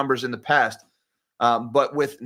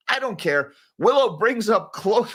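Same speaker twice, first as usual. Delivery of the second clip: ordinary delivery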